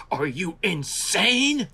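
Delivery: stretched, incredulous put-on voice; imitating Harryhausen. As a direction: drag it out in amazed disbelief. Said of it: "he goes".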